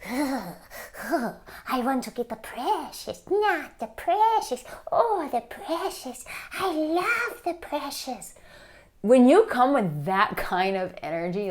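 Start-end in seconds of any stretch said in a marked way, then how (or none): 0.1-8.3 high, slow, hissing creature voice clutching the precious; Smeagol impression. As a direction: covet it like Gollum, slow and high. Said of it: "like my Smeagol example".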